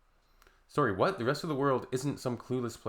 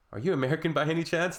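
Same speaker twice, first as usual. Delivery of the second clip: quick, higher mocking question; sarcastic catchphrase; 5 semitones higher, 4 dB louder, faster than usual. Real, not acted. Proposing a new catchphrase, quick mockery. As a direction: needle them with it. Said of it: "should be our new phrase".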